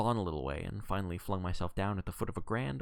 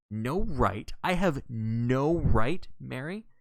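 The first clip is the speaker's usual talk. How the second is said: slow, higher, louder stricken question; unsteady disbelief; 4 semitones higher, 6 dB louder, much slower than usual. A narrator's reading. John stricken before his wife Mary, unsteadily incredulous. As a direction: draw out the repeat in wounded disbelief. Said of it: "he said unsteadily".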